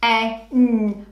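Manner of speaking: P-A-N sounded out letter by letter; sounding out words. Shown as a demonstration. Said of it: "Let's do it together".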